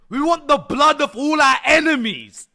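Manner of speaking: loud, high, drawn-out battle cry; rally the horde with hammed-up bloodlust; mock war cry. Player demands gore from his developer, mock war cry.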